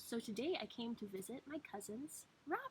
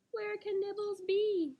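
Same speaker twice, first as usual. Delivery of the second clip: excited question; 9 semitones higher, 8 dB louder than usual. Acted. The teacher asks the children where the rabbit is.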